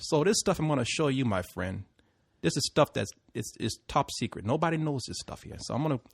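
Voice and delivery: hushed, secretive Puerto Rican cabbie; soft, conspiratorial mock Puerto Rican driver; bad Puerto Rican accent. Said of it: "I'm gonna do it bad accent".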